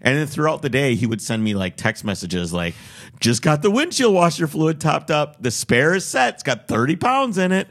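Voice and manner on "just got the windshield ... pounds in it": higher, louder, stretched-out proud updates; eager seller imitation. Buyer retells the seller's texts, in a mock-eager voice. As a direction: mimic an over-keen seller reporting in.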